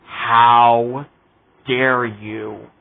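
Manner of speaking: loud, low "How", then a sharp "dare you"; indignant outrage. Retold or imitated. Vlogger recalling his fury at the show, outraged. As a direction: hurl it like an offended fan. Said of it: "I was like".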